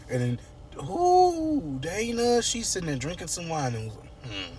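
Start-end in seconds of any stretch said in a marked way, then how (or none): 0.9-1.8 long, high-pitched exasperated ooh; drawn-out high exclamation. Real, not acted. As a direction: let out a falsetto ooh. Exclaims over Dana.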